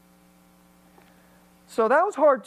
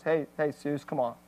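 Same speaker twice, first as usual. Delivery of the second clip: softer and lower, a calm nudge; gentle reminder; 6 semitones lower, 5 dB quieter than usual. Retold or imitated. Friend Young calming the angry pastor, gentle soft reminder. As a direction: imitate a friend's quiet, calming hush. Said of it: "that's his gentle reminder".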